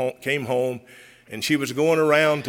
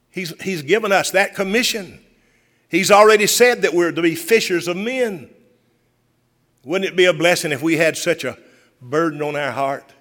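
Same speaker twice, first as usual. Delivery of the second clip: louder, lifted pulpit delivery of scripture; full-voiced preaching; 5 semitones higher, 6 dB louder than usual. Pastor proclaiming Jesus' commission to the church, fervent preaching.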